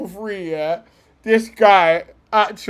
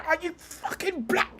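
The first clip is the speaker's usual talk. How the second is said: high, softer mock seething; imitated outburst; 9 semitones higher, 11 dB quieter than usual. Fan imagines the pundit a second away, mock seething. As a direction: imitate anger held in, pitched up. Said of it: "he's a second away from saying".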